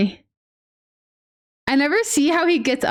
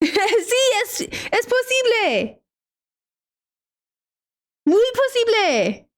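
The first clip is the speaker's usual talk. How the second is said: playful high Spanish; 8 semitones higher, slower than usual. Pretend.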